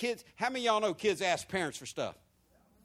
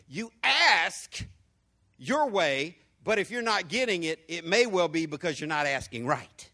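loud preaching; 5 dB louder than usual. Acted.

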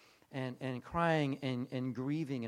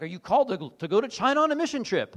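mock grand calling; 7 semitones higher, 9 dB louder than usual.

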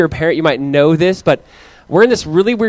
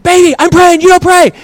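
put-on high, loud bossy little boy; son scolding sister; 13 semitones higher, 8 dB louder than usual. Son telling his little sister off at prayer, high and loud. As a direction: scold like a smug big brother. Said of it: "He's like".

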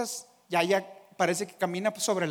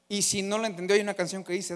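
normal talking voice

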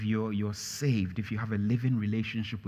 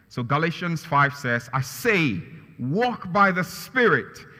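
loud, high sermon climax; raised pulpit voice; preaching at full force; 5 semitones higher, 6 dB louder than usual. Acted. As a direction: preach it out to the church. Preaching builds up.

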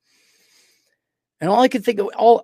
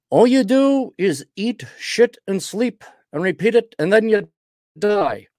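imitating Tony's way of talking; 8 semitones higher, 4 dB louder than usual. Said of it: "'cause he talks like this".

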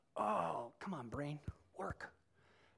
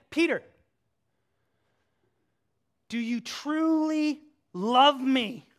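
louder, slow, searching question; pressing Jesus; 5 semitones higher, 9 dB louder, slower than usual. Retold or imitated. Jesus asking Peter a third time, slow and louder. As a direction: lean in and ask slowly, louder.

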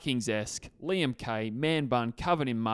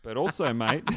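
ordinary talk, nothing marked

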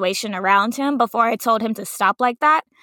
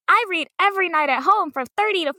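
higher, sing-song kindergarten teacher; raised teacher voice; 8 semitones higher than usual. Retold or imitated. Teacher telling her class she loves reading, higher and sing-song. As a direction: lilt it up like a hurt teacher. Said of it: "my voice raised".

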